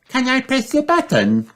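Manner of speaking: put-on very high, eager child piglet; high-pitched eager request; excited Peppa. A narrator's reading. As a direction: ask excitedly in a high little-pig voice. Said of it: "asks Peppa excitedly".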